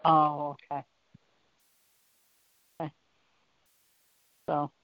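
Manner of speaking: usual speaking voice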